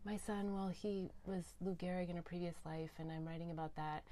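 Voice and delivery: soft, hushed and hesitant; a hushed, bashful confession; shy. Retold or imitated. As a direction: tell it shyly, half whispering. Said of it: "kind of shyly".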